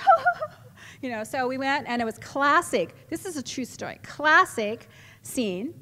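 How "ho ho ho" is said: very high, loud panicky hoots; high-pitched mock alarm; startled hoots. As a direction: yelp in high little bursts. Imitating herself dodging practice punches.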